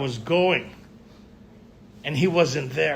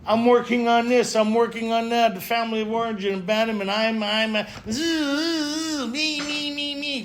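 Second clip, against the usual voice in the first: higher-pitched mock droning, building into a whining buzz of me-me-me; self-absorbed rambler; 6 semitones higher than usual. Member listing personal work to anyone around, hammed-up self-absorbed drone. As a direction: parody someone endlessly reciting their issues. Mocks endlessly self-centred talk.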